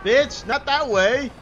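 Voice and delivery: high, loud yelling; angry protest. Real, not acted. Player's throw veers wrong, angry protest. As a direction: shout at it to turn back.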